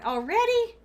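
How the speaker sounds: high, loud cry of disbelief; exasperated disbelief. Real, not acted. Streamer reacts to an interruption, high exasperated cry. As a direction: groan out already in dismay. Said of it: "Oh, God".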